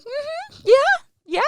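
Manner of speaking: very high squeaky nervous agreement; forced yes. Retold or imitated. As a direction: squeak out a yes you don't mean.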